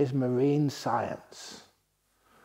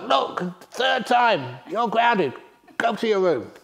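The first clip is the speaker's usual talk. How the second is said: loud, stern dad telling off; slow and firm on third time, then snap out the punishment; exasperated father sending son to room; scolding father; 9 semitones higher, 6 dB louder, slower than usual. Retold or imitated. Father scolding child in story.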